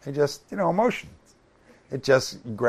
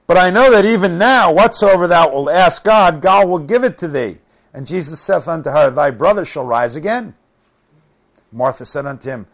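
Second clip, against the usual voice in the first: raised, emphatic Bible-reading voice; scripture reading; 2 semitones higher, 8 dB louder than usual. Acted. Preacher reads Martha and Jesus' exchange, emphatic scripture reading. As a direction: proclaim the verses to the room.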